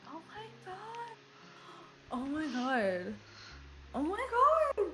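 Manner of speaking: hushed, gasping disbelief; stunned little exclamations under the breath; astounded. Acted. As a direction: gasp it softly, rising in shock.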